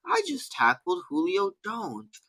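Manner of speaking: slow, soft, understated mock amazement; chill Nick Bosa. Podcast host mimics rookie telling teammate about a tackle, slow and chill. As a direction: imitate an athlete drawing out a mild brag. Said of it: "he was talking like that even on the field".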